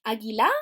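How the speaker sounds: Águila wrongly stressed on the final LA, rising; Águila with a lengthened, lifted ending; stress on last syllable. Shown as a demonstration. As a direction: say Águila as a-gui-LAA, offered as an option. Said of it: "¿dónde va el acento?".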